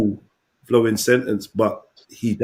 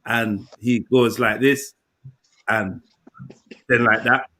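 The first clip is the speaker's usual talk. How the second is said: halting slow mimic; 2 semitones lower, slower than usual. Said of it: "concentrating on the speed pattern".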